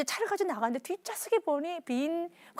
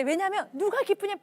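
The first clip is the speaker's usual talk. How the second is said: ordinary talk, nothing marked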